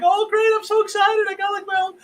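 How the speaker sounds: high, gushing fake excitement; mock the protesters with fake glee; mocking excited voice. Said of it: "mocking them".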